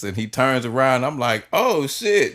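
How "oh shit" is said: high-pitched mock shock; a jolt of surprise at spotting the star; imitating his own startled reaction.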